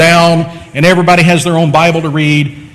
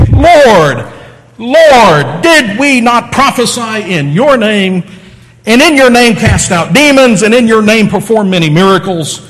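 insistent appeal; 5 semitones higher, 3 dB louder than usual. Acted. Many say to Jesus on that day, insistent appeal. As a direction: plead your case emphatically, dwelling on each phrase. Claimants plead with Jesus in scripture.

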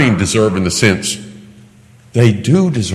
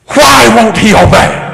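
high, loud despairing question; exasperated mom; 14 semitones higher, 7 dB louder than usual. Retold or imitated. Mom wonders why Junior won't obey, loud high exasperation. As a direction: throw up your hands in disbelief.

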